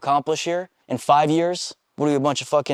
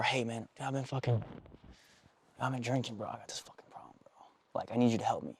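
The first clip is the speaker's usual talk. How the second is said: much softer, dropping to a near whisper; struggling confession; 3 semitones lower, 14 dB quieter than usual. Retold or imitated. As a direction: confide a problem quietly, almost whispering.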